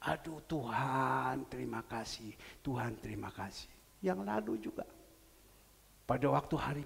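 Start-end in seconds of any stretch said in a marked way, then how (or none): 0.1-3.7 hushed thanks. Retold or imitated. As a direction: breathe out the thanks. Pastor thanks God.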